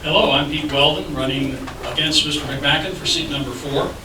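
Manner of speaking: normal talking voice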